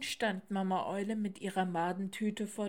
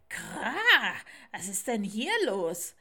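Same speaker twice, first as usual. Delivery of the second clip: croaking, puzzled raven; 2 semitones higher, 8 dB louder than usual. Acted. Raven arrives and asks what is happening, puzzled croak. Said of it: "krächzte verwundert".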